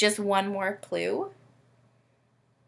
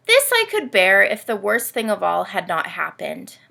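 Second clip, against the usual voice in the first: lightly voiced proud, self-pitying camel narrator; reading to children; 3 semitones lower, 3 dB louder than usual. Host reading Humphrey's story to children, gently dramatic. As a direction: read it as a vain camel feeling sorry for itself.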